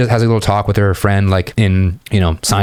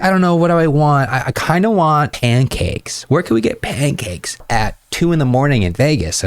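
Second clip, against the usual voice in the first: put-on indecisive girl; 6 semitones higher than usual. Friend at the club wondering aloud where to get pancakes, girly and higher. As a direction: play the girl mulling over pancakes, up high.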